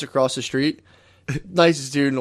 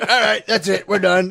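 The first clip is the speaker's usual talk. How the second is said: high-pitched impersonation of calling it done; brusque wrap-up; 6 semitones higher than usual. Retold or imitated. Grandfather chucking his flower down, imitated brusque dismissal. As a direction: dismiss everyone, mock matter-of-fact. Retold grandfather ending funeral.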